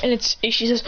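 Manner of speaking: ordinary delivery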